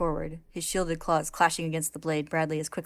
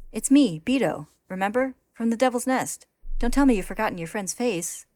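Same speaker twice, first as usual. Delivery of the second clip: higher, louder, eager pleading; eager babble; 6 semitones higher, 6 dB louder than usual. A narrator's reading. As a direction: rush it out, desperate to be remembered. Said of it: "Beto continues to babble".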